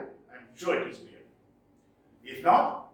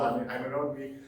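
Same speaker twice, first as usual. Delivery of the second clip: usual speaking voice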